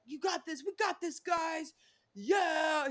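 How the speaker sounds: high, hammed-up pep talk; mock motivational. Contestant rallying the guys before group date, mock motivational. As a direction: imitate a corny team rally, pitched well up. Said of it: "did some weird motivational moment".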